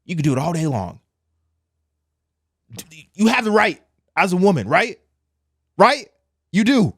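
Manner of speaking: loud and high, hammering the point; insistent near-shout. Real, not acted. Pressing women viewers for agreement, loud and high. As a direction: demand an answer, voice up.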